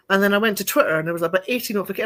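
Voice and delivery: normal talking voice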